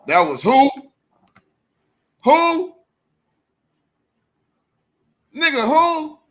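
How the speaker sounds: high, loud incredulous "who?"; outraged high-pitched who; shocked disbelief. Real, not acted. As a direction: squeal out the question in disbelief. Mock disbelief at rumor.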